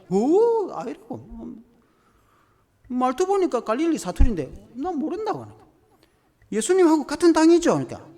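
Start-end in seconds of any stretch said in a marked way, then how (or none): 0.1-5.4 high, loud, panicked denial; frantic denial. Retold or imitated. Pastor voicing Peter denying Jesus to the servants, loud and frantic. 6.5-7.7 high, loud accusing question; pressing accusation. Retold or imitated. Servant pressing Peter about being with Jesus, loud and high.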